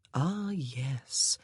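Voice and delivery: soft near-whisper aside; whispered inner realisation; hushed thought. A narrator's reading. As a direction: breathe it to yourself.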